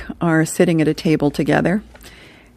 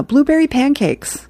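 put-on higher-pitched little kid voice, falling at the end; kid's order; 9 semitones higher than usual. Hammy orders breakfast from Mom, in a high kid voice. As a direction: chirp the order like an eager small child.

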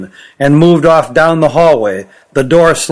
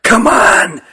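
ordinary delivery, nothing marked

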